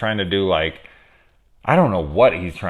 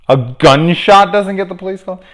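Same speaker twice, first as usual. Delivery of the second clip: indignant disbelief; 11 semitones higher, 9 dB louder than usual. Real, not acted. Outraged comparison to own police call.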